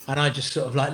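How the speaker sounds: ordinary talk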